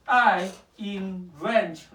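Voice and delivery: each word drawn out, very slow; slow dictation. Real, not acted. Tutor dictating a for loop to Eric, very slow. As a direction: spell the code out slowly so he can type it.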